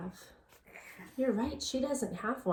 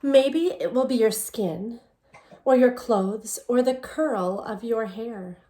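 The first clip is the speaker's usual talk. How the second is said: put-on reading a picture book aloud to children, a little louder; story narration; 6 dB louder than usual. A narrator's reading. Teacher reading the book to her students in story narration. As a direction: tell the story gently to the class.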